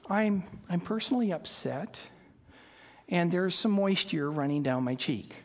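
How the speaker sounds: soft, low, restrained report of upset; understated upset. Shown as a demonstration. Preacher showing what wailing is not, understated upset. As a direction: play the upset down, quietly and low. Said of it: "not the kind of word that is saying".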